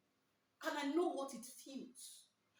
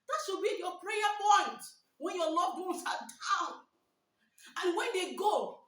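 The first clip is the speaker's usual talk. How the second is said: loud preaching; 4 semitones higher, 8 dB louder than usual. Acted. Louder preaching.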